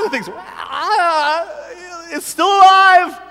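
high, loud, half-laughing panic; panicked yelling. Retold or imitated. Kid with the gerbil on his finger, panicked shouting. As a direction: yell like the gerbil won't let go.

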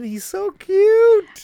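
high, loud, slow gush. Real, not acted. Gushing over a friend's new baby.

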